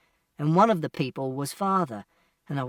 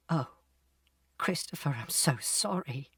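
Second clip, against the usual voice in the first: distressed apology; 7 dB quieter than usual. A narrator's reading. Mother says sorry to Christopher, softly distressed.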